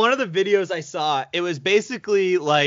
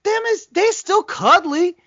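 cutesy gush; 11 semitones higher, 5 dB louder than usual. Real, not acted. Describing the fully grown pig, doting coo. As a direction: coo over the giant pig. Gushing about big pig.

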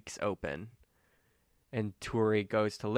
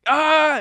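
loud high cry of joy; excited yell; 12 dB louder than usual. Acted. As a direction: whoop with victory. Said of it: "and he's like".

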